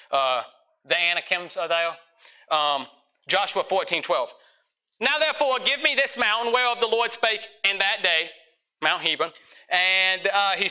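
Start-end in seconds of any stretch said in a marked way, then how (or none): 5.0-8.3 raised, proclaiming scripture read. Shown as a demonstration. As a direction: read it out like a preacher. Reads King James scripture aloud.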